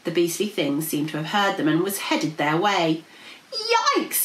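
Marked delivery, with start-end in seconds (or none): 3.5-4.2 high-pitched cartoon yikes; alarmed yelp. A narrator's reading. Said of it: "yelled Princess P".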